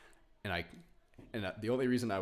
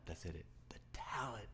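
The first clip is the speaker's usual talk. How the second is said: whisper; 9 dB quieter than usual. Real, not acted. Repeated in whisper.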